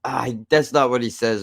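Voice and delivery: ordinary delivery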